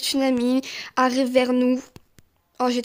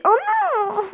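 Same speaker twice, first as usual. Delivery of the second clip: dismayed outcry; 9 semitones higher than usual. Real, not acted. Player losing at the last moment, dismayed outcry. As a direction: cry out as victory slips away. Dies in the game.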